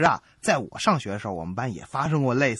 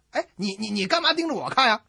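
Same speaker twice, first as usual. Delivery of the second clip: normal talking voice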